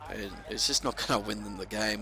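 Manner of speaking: normal talking voice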